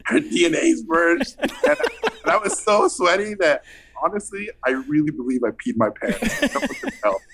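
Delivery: words broken by laughter; real laughter through the words; laughing. Real, not acted. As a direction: tell it while cracking up. Laughing while telling.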